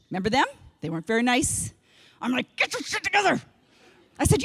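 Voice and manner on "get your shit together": a hint of a gruff coach barking; tough old coach. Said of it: "a nineteen seventy-five basketball coach".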